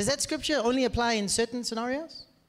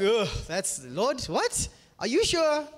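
mock disbelief; 3 semitones higher, slower than usual. Voicing a doubting believer to God.

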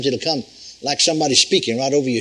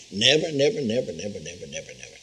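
each never softer and lower until gone; fading repetition; 9 semitones lower, 7 dB quieter than usual. Real, not acted. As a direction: let the nevers die away. Repeated never fading away.

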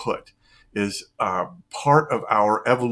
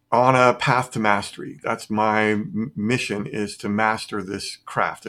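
normal talking voice

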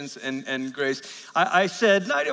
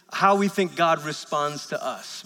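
ordinary delivery

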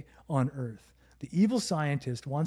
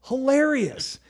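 excited; 10 semitones higher, 6 dB louder, slower than usual. Real, not acted. Gushing about favourite show.

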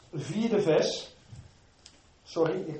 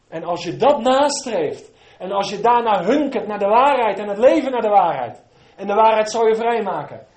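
full-force preaching; 3 semitones higher, 10 dB louder than usual. Acted. Preacher crying out to the congregation, loud and fervent. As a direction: preach it out with all your fire.

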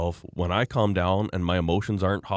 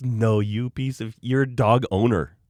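ordinary talk, nothing marked

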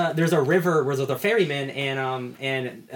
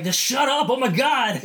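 high, exasperated mock yell; fed up, yelling at the chatty head; annoyed shout; 10 semitones higher, 4 dB louder, slower than usual. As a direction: snap it out like a fed-up hero. Exasperated Headlopper quote.

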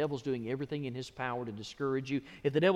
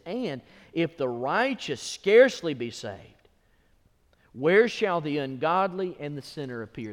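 declaimed scripture; 3 semitones higher, 9 dB louder than usual. Real, not acted. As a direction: thunder the question out. Declaims verse loudly.

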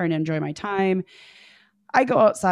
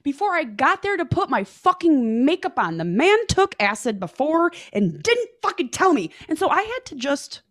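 indignant; 8 semitones higher than usual. Real, not acted. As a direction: vent the outrage, pitch up. Outraged about date on acid.

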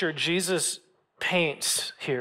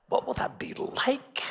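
a breathy unvoiced whisper, pitched up; hushed wondering; 7 semitones higher, 5 dB quieter than usual. Real, not acted. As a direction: wonder it aloud under your breath. Musing about living forever.

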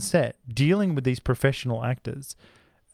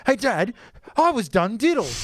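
mock accusing child; 9 semitones higher than usual. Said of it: "and you go".

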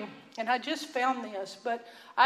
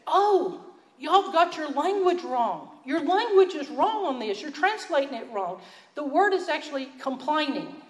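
retold, higher and louder, insisting they got it wrong; corrective friend; 4 semitones higher, 4 dB louder than usual. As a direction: play the friend who won't let the mistake pass, a notch up. Israeli friend correcting the translation.

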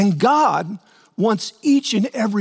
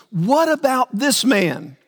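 higher, slow, pointed question; indignant Peter; 7 semitones higher, slower than usual. Retold or imitated. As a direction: jab the question at Jesus. Peter comparing himself to John.

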